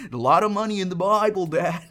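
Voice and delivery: loud, high-pitched mock cheer; sarcastic teasing. Sarcastic teasing of absent dad.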